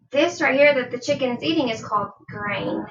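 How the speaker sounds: ordinary talk, nothing marked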